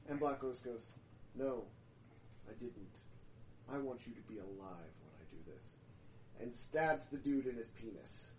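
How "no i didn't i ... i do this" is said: lower, quieter, fast, cold; cold calm reply. Acted. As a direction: say it low and icy.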